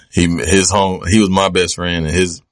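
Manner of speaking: usual speaking voice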